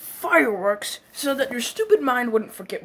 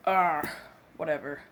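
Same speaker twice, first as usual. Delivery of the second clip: usual speaking voice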